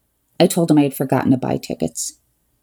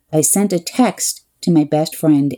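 usual speaking voice

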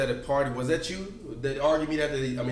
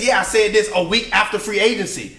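loud rant; 6 semitones higher, 6 dB louder than usual. Real, not acted. Heated argument, loud rant.

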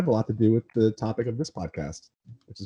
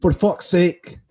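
higher-pitched, Scottish-sounding Northern English attempt; bad Northern English accent; 6 semitones higher, 4 dB louder, slower than usual. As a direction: badly imitate a cousin's Northern English accent. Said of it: "this is how my good friend's cousin says it".

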